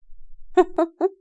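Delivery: high, light laugh; teasing giggle. A narrator's reading. Daughter teasing her mother, giggling.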